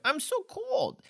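high-pitched mock whine; whiny. Imitating drunk man outside complaining about the cold, whiny. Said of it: "He whines like such a little bitch about the cold".

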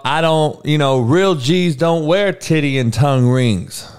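normal talking voice

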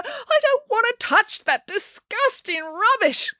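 high, slow whiny protest; childish high-pitched wail. A narrator's reading. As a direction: wail in revulsion, dragging the words. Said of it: "wailed Matilda".

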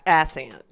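ACCENT without its k sound, "ass-ent". Real, not acted. Co-host fumbling the word, ACCENT said as "ass-ent". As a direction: drop the k in ACCENT. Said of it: "I usually say it like that for fun".

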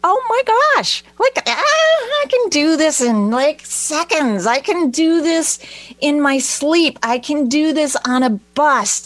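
high, lively bragging; playful high boast, I can do this anywhere; excited boast. Retold or imitated. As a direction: crow that it's easy now. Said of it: "you know".

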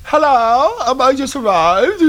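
high, far-off silly voice; high put-on voice. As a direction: squeak it out high as a newcomer. Same mock arrival voice.

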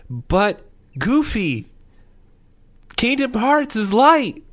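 high, loud mock-heroic cry; Sora impression. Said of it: "it's just gonna be Sora being like".

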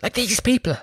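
high, aghast inner voice; mock horrified thought. [name] at the vaccine queue, high mock horror. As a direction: gasp it to yourself in dismay. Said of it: "continuing thought".

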